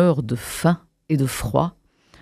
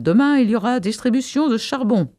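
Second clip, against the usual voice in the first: raised-pitch public announcement; crying out; 7 semitones higher than usual. A narrator's reading. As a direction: proclaim it to the crowd below. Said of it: "s'écria-t-il". (annonce lancée à la foule)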